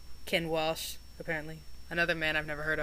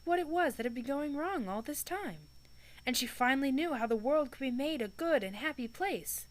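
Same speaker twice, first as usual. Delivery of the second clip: calm storybook reading voice; story narration; 4 semitones higher, 4 dB quieter than usual. A narrator's reading. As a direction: tell it like a book read aloud. Reads the novel aloud.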